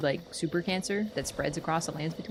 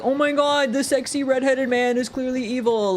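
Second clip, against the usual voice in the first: mocking fan voice; 5 semitones higher, 8 dB louder than usual. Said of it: "You guys are like".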